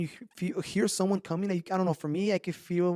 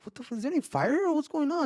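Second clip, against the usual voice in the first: high-pitched startled questioning; mock alarm, pitch jumping up; retold alarm; 8 semitones higher than usual. Telling a friend how he woke to burning feet, alarmed.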